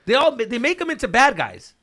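loud, high-pitched insistence; heated insistence. Real, not acted. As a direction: raise your voice to hammer the point home. Heated insistence on his movie theory.

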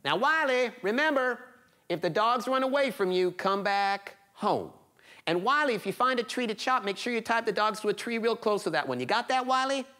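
lightly voiced mom giving rules; mother warning. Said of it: "his mother said".

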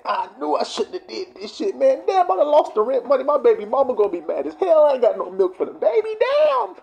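hammed-up lament building to a high cry; losing gambler lament. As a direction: despair over the lost rent money. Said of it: "They lose a bet".

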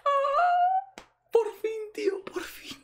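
a crying breath falling into a choked whisper; almost in tears with relief; tearful whisper. Real, not acted. Emotional after long wait.

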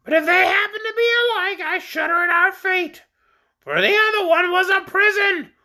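very high, squawky orc voice; high-pitched creature recounting its troubles; shrill creature. A narrator's reading. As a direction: tell it in a piercing bird voice.

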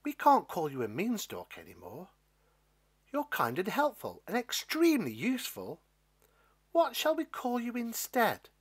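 put-on swooping, animated character line for children; animated Jack. A narrator's reading. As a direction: voice Jack eagerly asking the beanstalk. Jack addresses the stalk.

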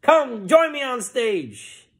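high, drawn-out revival call; fired-up preacher. Acted. Preacher calls the sick up on stage, fired up. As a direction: ring it out to the whole crowd. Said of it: "the preacher was fired up".

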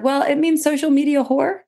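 explaining the slang word to Barb, high and sheepish; sheepish confession. Retold or imitated. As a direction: own up to it with a wince. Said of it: "I said".

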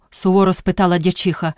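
ordinary delivery

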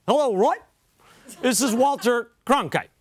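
measured veteran broadcaster introducing himself; Walter Cronkite. Retold or imitated. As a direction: imitate a famous anchorman's voice. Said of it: "the most famous voice in America".

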